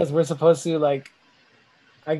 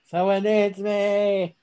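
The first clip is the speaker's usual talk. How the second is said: slow heroic drawl; 3 semitones higher, much slower than usual. Pretend. Repeating the Trump line slower.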